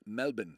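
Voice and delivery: Melbourne as MEL-bin; Aussie pronunciation. Shown as a demonstration. Streamer reminds viewers, Melbourne said MEL-bin. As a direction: drop the R in Melbourne, ending on "bin". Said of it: "It's not Melbourne".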